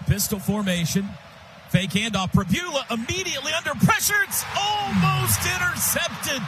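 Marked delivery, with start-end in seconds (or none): 2.3-6.4 rising alarm on a near interception; quick rising alarm, then slowing on "almost intercepted"; excited call. Real, not acted. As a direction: voice jumps up as the pass is nearly picked.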